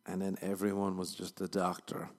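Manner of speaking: ordinary talk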